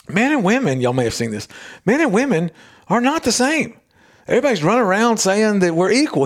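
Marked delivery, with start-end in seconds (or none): 0.1-0.8 high-pitched stand-up delivery, imitated; retold comedian. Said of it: "He's a comedian. And he says". 1.9-6.2 high-pitched stand-up delivery, imitated; retold comedian. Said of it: "He's a comedian. And he says".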